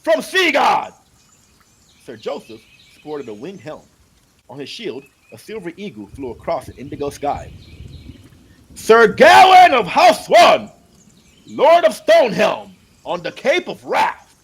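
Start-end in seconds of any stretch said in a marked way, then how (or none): 0.0-0.9 high, loud, slow ringing herald call; herald's call. Acted. Herald announcing a knight to the crowd, ringing call. 8.8-14.3 high, loud, slow ringing herald call; herald's call. Acted. Herald announcing a knight to the crowd, ringing call.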